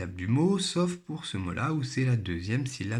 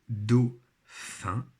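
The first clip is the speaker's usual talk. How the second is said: 1 word, slow. Shown as a demonstration. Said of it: "dictée très lente du mot modèle".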